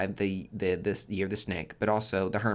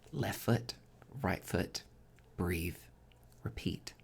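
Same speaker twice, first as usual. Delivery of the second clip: softer, calm and spaced out; gentle mantra; 3 semitones lower, 9 dB quieter, slower than usual. Shown as a demonstration. Reader guiding viewers through the mantra, soft and calm. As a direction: lull them with the mantra. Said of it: "repeats mantra softly".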